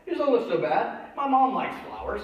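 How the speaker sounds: lightly voiced boy pleased with his find; kid Terry. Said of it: "and he goes".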